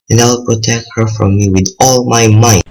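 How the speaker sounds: rising to a loud finish; defiant vow. Acted. Youth vowing to protect her from Death, forceful. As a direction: swear it forcefully, building in volume.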